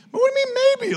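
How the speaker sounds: can't believe the answer you just got; a high, baffled "what do you mean?"; incredulous disbelief. Retold or imitated.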